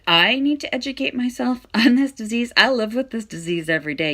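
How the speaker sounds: high loud indignant laugh; indignant outburst. Retold or imitated. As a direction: fire it back, outraged. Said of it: "I said".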